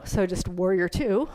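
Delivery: ordinary delivery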